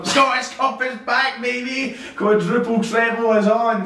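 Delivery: high, slow, drawn-out hype call; excited hype. Real, not acted. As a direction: announce it like a big return. Hyped announcement of cup return.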